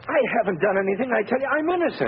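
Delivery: high, pleading insistence on innocence; accused driver pleading with the police sergeant; desperate protest. Acted. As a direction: beg the sergeant to believe you.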